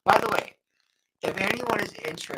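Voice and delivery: robotic glitchy mic voice; sounding like a robot. Real, not acted. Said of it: "You sound like a robot".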